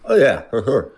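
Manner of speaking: mouth full. Real, not acted. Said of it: "I have ice in my mouth".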